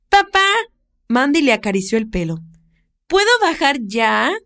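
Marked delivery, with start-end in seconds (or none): 0.1-0.5 put-on high, eager child calling out; little girl voice. Little girl calls the older woman about the cat, high child voice. 3.1-4.3 put-on high, eager child calling out; little girl voice. Little girl calls the older woman about the cat, high child voice.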